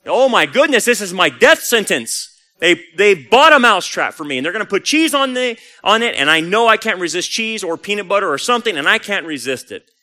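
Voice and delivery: hammed-up panicked mouse; freaking out. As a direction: play a mouse panicking over its doom. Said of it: "he was freaking out".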